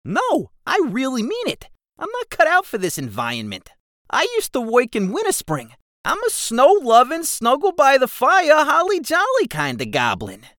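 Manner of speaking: high, bouncy goblin chatter; cheerful goblin voice. Acted. Keswick explains to Jigsaw he loves snow, high and bouncy. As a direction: sell the holly-jolly goblin, high and bright.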